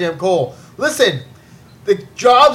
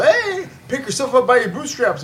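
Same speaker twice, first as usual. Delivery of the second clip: mock lecturing voice; 5 semitones higher than usual. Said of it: "you tell every minority in the world".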